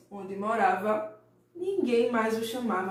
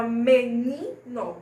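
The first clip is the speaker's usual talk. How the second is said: menino with NI punched loud and held; louder me-NI-no, middle syllable stretched; forceful stressed syllable; 5 dB louder, much slower than usual. Shown as a demonstration. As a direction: hit the NI of "menino" hard. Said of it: "ela vem aí com uma força".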